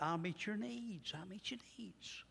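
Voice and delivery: the Lord soothing his flock; tender reassurance. Acted. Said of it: "but he says".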